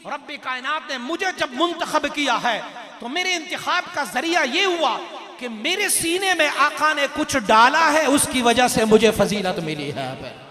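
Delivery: high, very loud sermon climax, falling in pitch at the end; full-force preaching. Acted. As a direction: build to a thundering proclamation. (इंतिहाई जोशीली, बुलंद तक़रीर)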